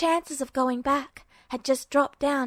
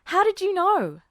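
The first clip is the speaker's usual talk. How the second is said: higher, louder startled question; 6 semitones higher, 4 dB louder than usual. A narrator's reading. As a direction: catch him off guard. Edward startled by Duo.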